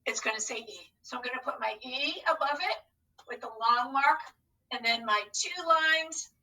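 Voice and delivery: ordinary talk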